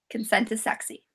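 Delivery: a book fan sighing over the hero, softly; soft sultry aside. Retold or imitated. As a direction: purr it under your breath. Playful swoon over hero.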